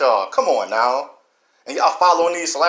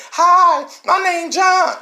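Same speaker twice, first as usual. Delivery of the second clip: much higher and louder, a mincing mock greeting; 5 dB louder, much slower than usual. Speaker mocks the village people coming in, high mocking voice. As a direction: squeak it out like a caricature.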